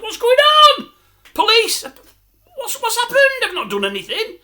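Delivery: high-pitched startled yelp of innocence; alarmed protest. Retold or imitated. Man dragged back by police, panicked high protest. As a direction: protest as if suddenly grabbed. Acted protest to officer grabbing him.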